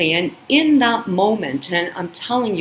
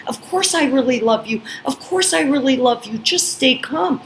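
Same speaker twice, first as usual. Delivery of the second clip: high, fast anxious pleading; reply in a rushed, high plea; pleading reassurance; 7 semitones higher than usual. Retold or imitated. She calms her raging date, high and pleading. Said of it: "I'm replying".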